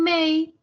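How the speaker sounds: "mei" ending in an i glide; "mei" with the final y sounded as i; sounds like I. Shown as a demonstration. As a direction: say "mei" as one syllable closing on i. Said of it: "Suena como una I".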